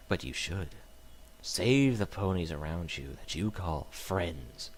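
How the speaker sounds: slow, low, menacing reading; a hint of a creepy villain; sinister note voice. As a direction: read it like a gloating stalker.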